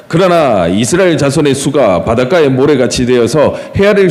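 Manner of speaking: solemn scripture reading. Acted. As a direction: read the verses out like a preacher at the pulpit. Reads the scripture passage aloud.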